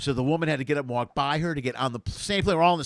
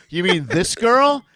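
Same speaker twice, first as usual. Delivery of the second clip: slow, loud and higher, dragging it out; slow pointed question; 5 semitones higher, 9 dB louder, slower than usual. Retold or imitated. Comic pointing to the woman beside him, slow loud loaded question. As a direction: let it land slowly and pointedly. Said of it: "And I go".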